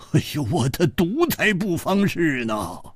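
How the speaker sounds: high-pitched, urgent protest; exasperated high cry; agitated insistence. Acted. Insists friend be treated first.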